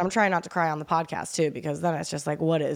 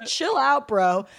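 telling off; 5 semitones higher, slower than usual. Retold or imitated. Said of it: "I was telling my sim god".

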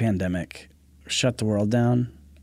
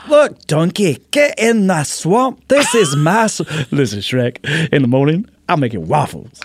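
big Scottish ogre, hammed up; Shrek impression; 3 semitones higher, 4 dB louder than usual. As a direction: play Shrek in a broad Scottish accent. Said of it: "I could do Shrek".

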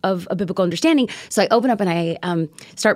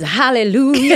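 churchy hallelujah; 3 semitones higher, 4 dB louder, slower than usual. Pretend. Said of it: "And the church says".

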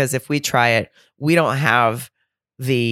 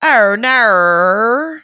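loud, high, exaggerated Australian accent; hammed-up Aussie drawl, swooping up at the end; 8 semitones higher, 7 dB louder than usual. Shown as a demonstration. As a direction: stretch it out in a broad Australian accent. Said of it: "only thing I can say in an Australian accent".

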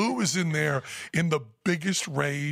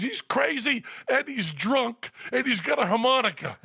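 amused Lou; 9 semitones higher than usual. Retold or imitated. Lou laughing hysterically.

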